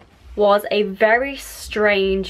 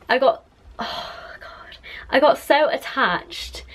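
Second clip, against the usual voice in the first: higher, tearful whisper; crying whisper; 4 semitones higher than usual. Real, not acted. Recalling giving up the stray cat, in a crying whisper. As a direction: push the words out through tears. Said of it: "I need to stop crying".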